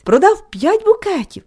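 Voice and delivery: put-on a boy beaming over his sales; beaming boast. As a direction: show off the sale with glee. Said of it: "сяяв хлопець".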